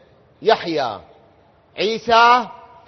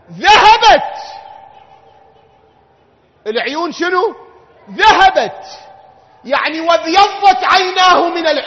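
high, loud, drawn-out lament; impassioned wail; 9 semitones higher, 10 dB louder, slower than usual. Real, not acted. Preacher wailing to the mourners, loud lament. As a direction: cry it out to break the congregation.

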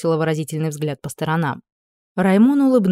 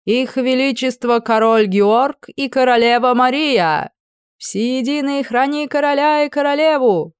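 slow, high, ringing herald's proclamation; grand entrance announcement; 6 semitones higher, 4 dB louder, slower than usual. A narrator's reading. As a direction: announce the royal couple to the hall. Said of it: "Герольд торжественно провозгласил".

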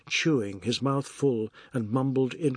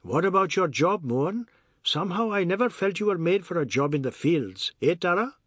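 higher, lilting character voice; needling Ajoda; 5 semitones higher than usual. Acted. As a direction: prod him, then turn for agreement. Ajoda needles Mohan about work.

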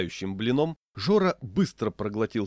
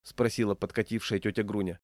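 plain reading voice

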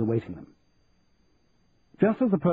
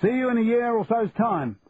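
higher Cockney shout; 9 semitones higher than usual. Acted. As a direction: call it back across the dock in Cockney. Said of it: "shouted Charlie".

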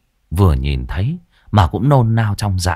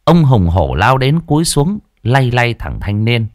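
ordinary delivery

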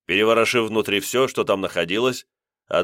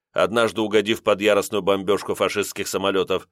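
ordinary delivery, nothing marked

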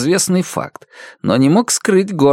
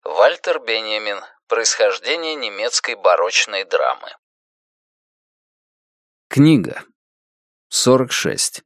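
ordinary delivery

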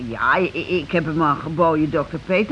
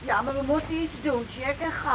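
anxious hushed plea; 4 semitones higher, 8 dB quieter than usual. Acted. Anxious wife urging action.

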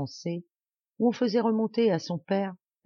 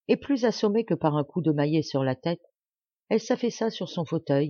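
ordinary delivery, nothing marked

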